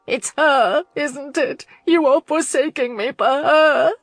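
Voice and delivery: crying accusation. A narrator's reading. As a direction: hurl the accusation through tears. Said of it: "she cried".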